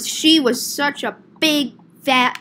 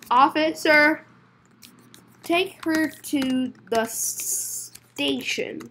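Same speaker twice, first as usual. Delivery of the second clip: slow, halting, soft-ending instruction; slow word by word; 2 semitones higher, 4 dB quieter, slower than usual. Acted. Telling the officer to take her away, slowly. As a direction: space out the words with long gaps.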